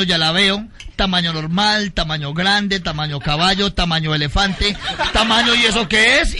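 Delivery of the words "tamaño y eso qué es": loud startled question, laughing; mock shock. Comedian to crowd, loud mock shock. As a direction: gasp at the size.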